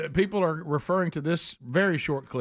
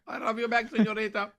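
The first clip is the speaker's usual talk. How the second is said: mock Kamala accent; 8 semitones higher than usual. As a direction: shout it like a rally accent. Mimicking with Spanish flair.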